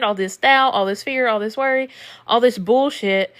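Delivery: normal talking voice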